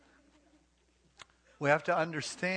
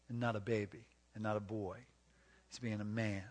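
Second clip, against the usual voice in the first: ordinary talk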